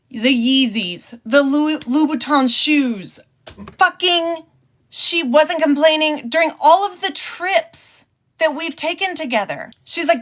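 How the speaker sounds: usual speaking voice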